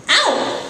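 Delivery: high yelp of pain; woman yelps when pinched, high; sharp ow. Acted.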